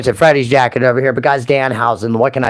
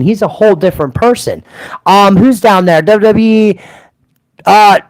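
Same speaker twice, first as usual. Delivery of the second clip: character voice; 5 semitones higher, 3 dB louder than usual. Acted. Back into The Voice character.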